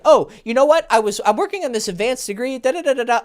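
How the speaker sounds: excited imitation of a rambler; animated rambling. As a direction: burst in with a sudden tangent. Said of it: "if you're gonna be like".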